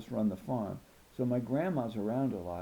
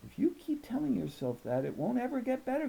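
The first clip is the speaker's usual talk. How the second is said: higher, quicker grandma voice; tough grandma; 13 semitones higher than usual. A narrator's reading. Grandma warns Danielle, quick and firm. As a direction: push back firmly as a tough grandma. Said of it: "Grandma said".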